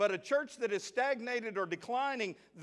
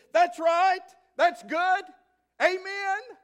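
high, loud gleeful amens; excited churchwoman; 12 semitones higher, 10 dB louder, slower than usual. Retold or imitated. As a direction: cheer it out like an eager lady in the pew. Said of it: "She got excited".

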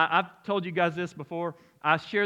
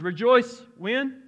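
slow, louder, higher prompt; call to congregation; 6 semitones higher, 6 dB louder, much slower than usual. Real, not acted. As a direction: draw the answer out of the crowd.

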